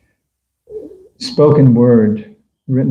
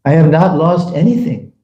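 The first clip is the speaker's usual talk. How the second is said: ordinary talk, nothing marked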